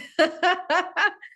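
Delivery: sudden high laugh at herself; embarrassed high laugh; burst of high laughter. Real, not acted. Laughs at own past behavior.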